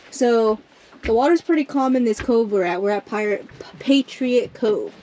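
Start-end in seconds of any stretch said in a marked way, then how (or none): none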